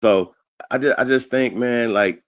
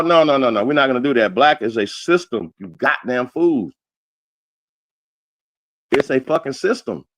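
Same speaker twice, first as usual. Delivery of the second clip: usual speaking voice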